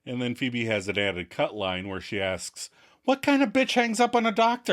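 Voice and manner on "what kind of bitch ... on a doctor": high, outraged question; indignant. Retold or imitated. Phoebe offended at being hung up on, high and indignant. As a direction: huff it out offended.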